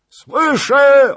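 high, very loud call back; shouted reply. A narrator's reading. Departing rider answers Zagloba, high shout. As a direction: holler the answer over a distance.

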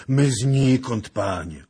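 put-on high, slow, loud plea of an old man; frightened old beggar. A narrator's reading. Captured old beggar answers an armed lord, frightened. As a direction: drag it out loudly, high and scared. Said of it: "Obaj klapali zębami ze strachu".

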